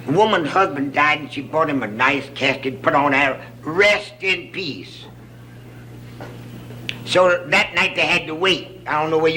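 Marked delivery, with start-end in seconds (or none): 3.6-5.0 slow reading. Retold or imitated. Reading the casket words slowly.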